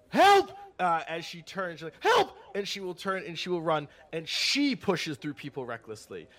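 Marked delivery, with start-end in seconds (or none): 0.1-0.5 high, loud cry for help; instinctive yell. Acted. Fleeing woman instinctively yells for help, high and loud. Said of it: "she will instinctually yell". 2.0-2.4 high, loud cry for help; sudden high-pitched shout; instinctive yell. Acted. Woman yells as she turns to run, high and loud. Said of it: "she will instinctually yell".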